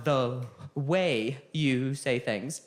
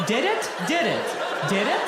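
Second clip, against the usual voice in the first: incredulous; 5 semitones higher than usual. Real, not acted. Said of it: "doubting the praise".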